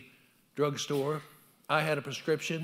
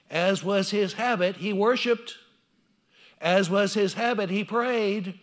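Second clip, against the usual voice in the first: loud, higher preaching cadence; 5 semitones higher, 8 dB louder, slower than usual. Acted. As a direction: proclaim it to the congregation. Preaching climax.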